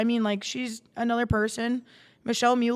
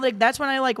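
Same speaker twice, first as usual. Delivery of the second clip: ordinary delivery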